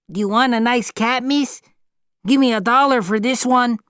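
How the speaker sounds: put-on a cheeky boy hawking a cat; street boy.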